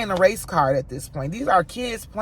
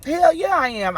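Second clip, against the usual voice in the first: defiant yes; 9 semitones higher than usual. Real, not acted. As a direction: own it boldly. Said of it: "disturbing the peace, defiant".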